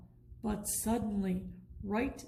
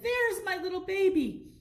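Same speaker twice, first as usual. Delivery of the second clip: doting penguin; 9 semitones higher, 4 dB louder than usual. A narrator's reading. Charlie finds his egg.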